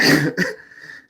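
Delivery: loud high burst of laughter; bursting giggle; real laugh. Real, not acted. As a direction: laugh out loud mid-line. Teller laughing mid-sentence.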